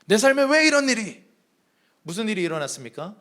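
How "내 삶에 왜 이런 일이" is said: high, louder protesting prophet voice. Acted. Habakkuk complains to God, high and loud. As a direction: demand why of God, pitched up. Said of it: "항의하는 선지자의 모습입니다".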